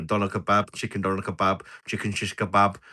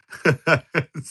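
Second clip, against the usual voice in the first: high put-on giggle, louder; attempted laugh impression; 7 semitones higher, 5 dB louder than usual. Streamer attempting friend's giggle. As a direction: try to copy his laugh. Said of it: "can't do his laugh".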